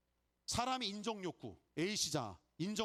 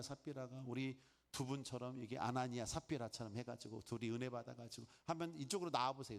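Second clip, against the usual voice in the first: ordinary talk, nothing marked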